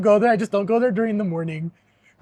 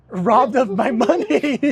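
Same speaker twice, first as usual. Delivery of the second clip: slow and louder, hammed-up victimhood; milk the word robbed like a fake victim; mock indignation; 5 semitones higher, 5 dB louder, much slower than usual. Mock outrage at the manager.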